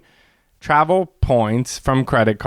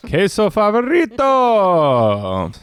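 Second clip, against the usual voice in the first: slow, higher put-on Spanish; mock Spanish show name; 8 semitones higher, much slower than usual. Translating the show's name, slow higher mock Spanish. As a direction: announce it grandly in Spanish.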